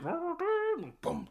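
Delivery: booming score done by mouth; imitated film music. Describing the scene's music, imitated booming score. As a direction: mouth the dramatic music with thumping beats. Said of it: "the music is like".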